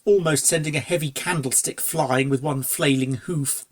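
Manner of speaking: ordinary delivery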